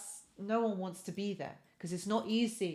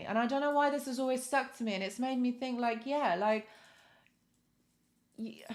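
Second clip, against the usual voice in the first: ordinary delivery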